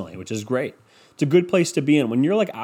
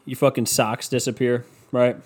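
normal talking voice

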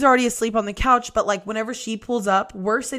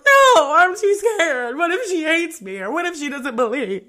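high mock wail, easing off at the end; scared whining; 7 semitones higher, 4 dB louder than usual. Medium begs off when sister pushes her, scared whining. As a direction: blurt it high and loud, then whine fearfully.

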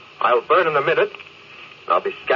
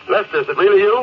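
higher, louder with disbelief; can't believe he hears him; surprised relief; 7 semitones higher, 4 dB louder, faster than usual. Acted. Stranded captain hearing his crewman on the radio, surprised and relieved.

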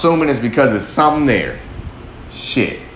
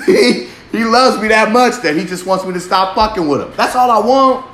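laughing; 8 semitones higher than usual. Real, not acted. Laughing through the line.

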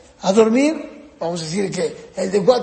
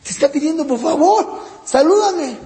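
put-on higher, a bit louder little kid begging; pleading child; 6 semitones higher, 3 dB louder than usual. Older child begging parents to greet him, mild pleading. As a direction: plead to be noticed. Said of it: "muchas veces no lo saludamos".

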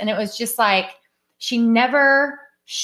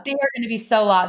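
usual speaking voice

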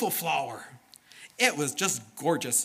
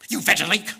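loud, higher-pitched name-calling; mock swearing; 5 semitones higher, 9 dB louder than usual. What we would call the dirty dog, mock angry insult. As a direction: hurl it as a make-believe insult. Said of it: "We would call him veggie swear words".

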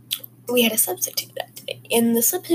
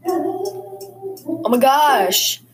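exasperated groan; 6 semitones higher, 4 dB louder, much slower than usual. Real, not acted. Exasperated as boyfriend calls.

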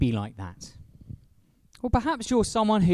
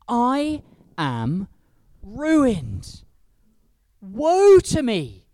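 anguished lament; 4 semitones higher, 5 dB louder, much slower than usual. Retold or imitated. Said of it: "I cried".

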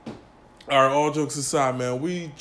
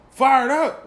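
much higher, louder offended echo; indignant drunk; 9 semitones higher, 8 dB louder than usual. Retold or imitated. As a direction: bristle at being called drunk. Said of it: "He's like".

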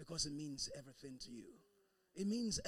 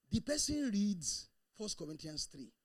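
usual speaking voice

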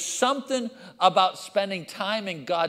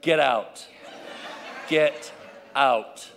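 low, punchy order with gaps between words; comic, firm dismissal; dismissive; 4 semitones lower, slower than usual. Acted. Father ordering kids out of his room, dismissive.

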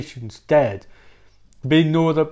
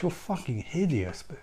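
normal talking voice

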